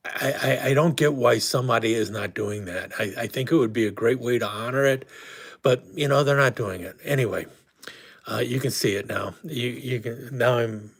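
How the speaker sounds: usual speaking voice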